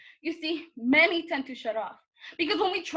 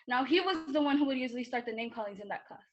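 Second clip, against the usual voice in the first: ordinary delivery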